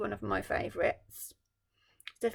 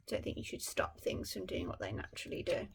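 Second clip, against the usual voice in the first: plain reading voice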